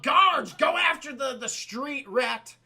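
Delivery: loud, high shouted order; Jafar commanding guards. Retold or imitated. Jafar sends his guards after Aladdin, shouted command. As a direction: call out sharply to the henchmen. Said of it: "Jafar's like".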